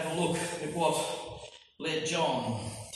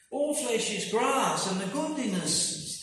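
scripture proclamation; 9 semitones higher, 6 dB louder than usual. Acted. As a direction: ring it out like a prophet. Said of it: "says Isaiah".

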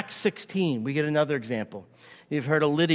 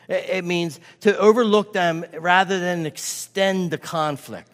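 ordinary talk

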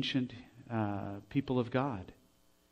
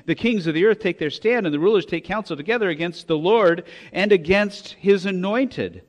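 a raised, ringing quote of the verse; scripture quote; 6 semitones higher, 7 dB louder than usual. Acted. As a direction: proclaim the verse again. Quotes Psalm two verse.

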